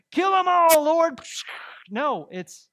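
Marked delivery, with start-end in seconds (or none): 0.1-1.2 high, loud mock war cry to God; mock bloodthirsty shout. Preacher parodying a vengeful prayer to God, high shout. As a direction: bellow it like a trigger-happy prayer.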